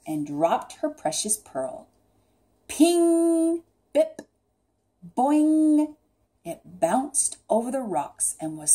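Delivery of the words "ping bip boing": high, loud ringing bounce noises; bouncing sound effects. A narrator's reading. Narrator voicing the dropped pearl, bouncing sound effects. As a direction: sing out each bounce, held high.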